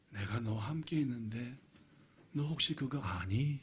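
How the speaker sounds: soft, low voice of God; gentle reminder. Acted. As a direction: ask quietly and tenderly, as God to David. (낮고 부드럽게 다정히 묻는 목소리)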